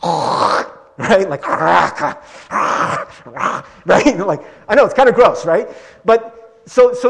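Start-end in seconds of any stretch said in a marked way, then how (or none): 0.0-3.8 loud throaty hawking "raka"; guttural spit-gathering noise. Shown as a demonstration. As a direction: hawk it up as if accumulating spit. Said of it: "let's see if I can do this".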